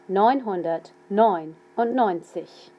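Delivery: slow dictation of Neunhundertneunundneunzig; slow and clear. Shown as a demonstration. Teacher dictating a number slowly to students. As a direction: Neunhundertneunundneunzig said slowly, each part separate. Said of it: "I'll say them slowly".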